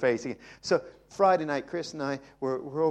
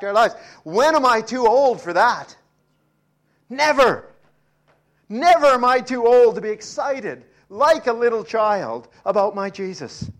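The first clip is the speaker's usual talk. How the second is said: louder and higher, building to a ringing cry; building preaching shout; 7 semitones higher, 11 dB louder than usual. Real, not acted. Preacher rousing the congregation, shouting with fervour. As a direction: preach it out full force.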